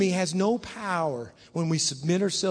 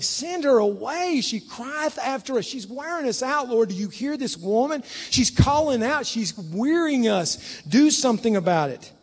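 exasperated pleading; 7 semitones higher, 4 dB louder than usual. Acted. Disciples begging Jesus to send her away, exasperated pleading. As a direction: whine it up high, stretching the key words.

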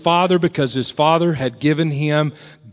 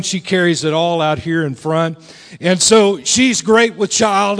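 ordinary delivery

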